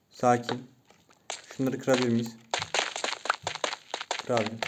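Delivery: usual speaking voice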